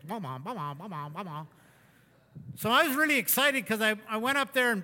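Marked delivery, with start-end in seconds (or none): 0.0-1.5 soft, low thumping ba-bum, like a pounding pulse; imitated heartbeat. Shown as a demonstration. Speaker showing a racing pulse after the climb, soft imitated heartbeat. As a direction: mouth the heartbeat quietly in the head. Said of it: "You just count as your head goes".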